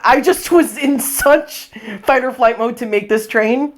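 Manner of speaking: ordinary talk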